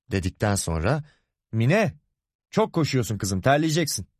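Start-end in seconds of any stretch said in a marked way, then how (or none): 1.5-4.1 tiz perdeden kızına sesleniş; koşan kızına uzaktan seslenerek uyar; seslenme. A narrator's reading. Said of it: "diye kızına seslendi". (baba, kızına seslenerek)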